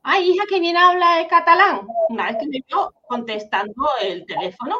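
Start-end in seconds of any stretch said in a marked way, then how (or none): 0.0-1.9 louder, higher voice of a doting grandmother. Retold or imitated. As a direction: praise her like a proud old grandma. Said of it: "mi abuela decía".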